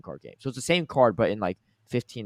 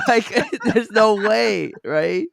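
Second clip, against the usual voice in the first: laughing; 13 semitones higher, 8 dB louder, much slower than usual. Real, not acted. Laughing at his own bit.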